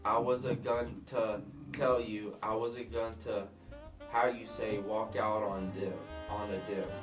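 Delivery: put-on halting broken English in a thick accent; thick foreign accent. Killer explaining the bodies to Dan, thick foreign accent. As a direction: grope for words in a heavy foreign accent. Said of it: "whoever the hell has this thick accent".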